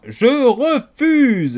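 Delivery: very slow, loud, high and strange cook voice; defiant refusal. A narrator's reading. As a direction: drag out the refusal stubbornly. Cook defies the king.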